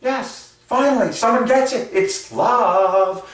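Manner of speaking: high-pitched happy squeal; elated gush. Pretend. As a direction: squeal it like a fan who finally feels understood. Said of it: "She's like".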